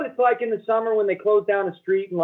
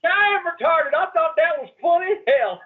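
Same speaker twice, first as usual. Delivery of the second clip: mock laughing guy; 8 semitones higher than usual.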